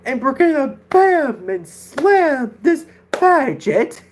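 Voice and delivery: drawn-out, high, swooping catchphrase voice; Jackson Ross imitation. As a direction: stretch out each word in a hammed-up imitation. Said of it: "my best imitation of his voice".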